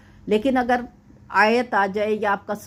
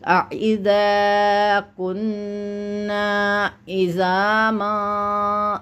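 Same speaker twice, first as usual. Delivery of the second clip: slow, drawn-out Quranic recitation; much slower than usual. Shown as a demonstration. Teacher recites the verse slowly for the student. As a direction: chant each syllable long and measured.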